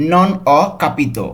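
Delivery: slow, careful Italian phrase; slow model reading. Shown as a demonstration. Teacher models the whole phrase slowly for students. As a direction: say it slowly and clearly for learners. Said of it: "পুরোটা একসাথে কী হবে?".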